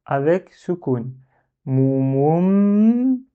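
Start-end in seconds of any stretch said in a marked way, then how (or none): 1.6-3.2 "Mou, mou, m" ending on a long, higher hummed m; lips retracted on m. Shown as a demonstration. Teacher showing mim with sukun, long closed "m" after "Mou, mou". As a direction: round each "mou", then close and hold "m". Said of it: "il faut rétracter les lèvres pour en prononcer le mim".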